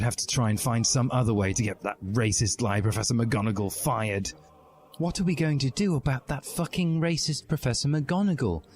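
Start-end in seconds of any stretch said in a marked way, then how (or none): 5.0-8.6 tearful. A narrator's reading. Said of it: "as sexy tears poured down her face".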